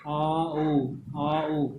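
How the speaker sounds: आ and उ held long, one after another; two vowel sounds. Shown as a demonstration. Teacher isolating the sounds in माउस, vowels sounded out. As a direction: linger on आ, then move to उ. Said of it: "उ का sound आ रहा है".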